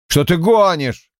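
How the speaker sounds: high, stung indignant snap; deeply offended retort; wounded outrage. A narrator's reading. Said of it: "глубоко уязвлённый, вновь сорвался на крик".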